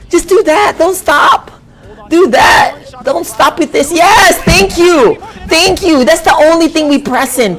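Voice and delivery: excited cheering. Real, not acted. Streamer cheering his favored player's winning move, high and excited. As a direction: yell at the screen for him to keep pressing that button.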